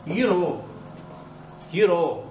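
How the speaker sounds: Scottish YOU KNOW, know said as ro; Scottish accent. Shown as a demonstration. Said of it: "on va dire c'est ro".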